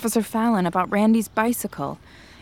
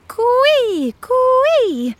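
high, loud bird call; calling in a forest; 14 semitones higher, 6 dB louder than usual. Retold or imitated. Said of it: "A little like this".

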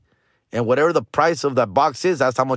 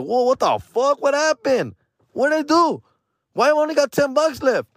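high-pitched mock panic; acted-out, high, stunned whine; shocked panic; 12 semitones higher than usual. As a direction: wail in disbelief at the loss. Reacts to losing money.

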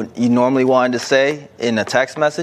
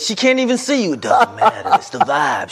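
usual speaking voice

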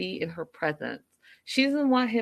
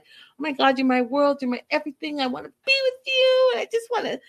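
put-on gushing teen boy voice, climbing much higher; lovesick first love; 8 semitones higher, 4 dB louder than usual. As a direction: swoon over her like a smitten first-timer. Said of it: "he's gonna be more intense and more like".

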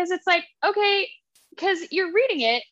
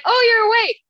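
much higher and louder, startled; Kieran surprised; 10 semitones higher, 6 dB louder than usual. Retold or imitated. Kieran greeting Poppy as she wakes, startled high voice. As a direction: act a startled friend greeting her. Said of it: "Kieran's like".

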